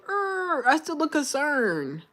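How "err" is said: higher-pitched mock growl; half-hearted attempt at sounding mad; failed mad growl. Shown as a demonstration. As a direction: put on an unconvincing angry "err". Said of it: "that's not a mad face".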